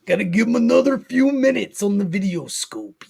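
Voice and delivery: mock wrestling announcer hype; wrestler promo voice. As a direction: play the pumped-up wrestler.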